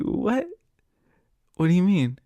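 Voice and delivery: high, loud disbelieving what; retold stunned question; shocked what. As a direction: blurt it out in disbelief. Shocked reaction in retold chat.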